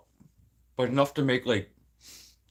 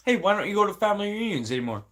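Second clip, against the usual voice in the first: higher, quick put-on questioner; mock nosy relative; 6 semitones higher, much faster than usual. Imitating someone asking about family reunions, mock chirpy. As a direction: rattle off the question in mock innocence.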